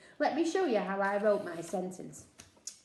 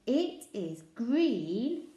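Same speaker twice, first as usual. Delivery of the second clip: slow, word by word; slow, careful phonics read; slow reading aloud; 3 dB louder, much slower than usual. Shown as a demonstration. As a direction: read it slowly for a child. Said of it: "teacher reads the clue slowly".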